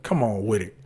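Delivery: ordinary talk, nothing marked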